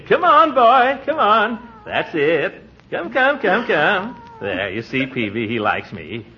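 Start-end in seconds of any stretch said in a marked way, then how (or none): 0.1-4.2 high, cheery coaxing; coaxing the cat. Acted. Uncle coaxing the cat toward him, high and cheery. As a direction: coax the cat to come.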